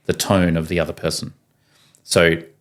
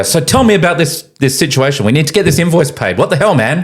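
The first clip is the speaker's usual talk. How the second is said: energetic matey tone; 8 semitones higher, 4 dB louder than usual. Retold or imitated. Speaker retells own phone call.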